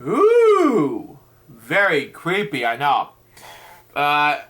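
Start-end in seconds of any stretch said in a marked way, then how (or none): 0.0-1.2 spooky ooh. Real, not acted. Spooky reaction to crying pants.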